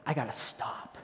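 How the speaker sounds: breathless near-whisper, soft; out of breath. Acted. Man winded from chasing two guys, breathless. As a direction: gasp it quietly, winded. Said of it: "I'm out of breath".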